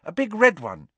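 put-on a nervous boy pleading; anxious schoolboy Neville. A narrator's reading. Neville answers.

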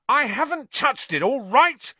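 loud, high defensive yell; roared back. A narrator's reading. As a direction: shout down an accusation. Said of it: "Ron roared back".

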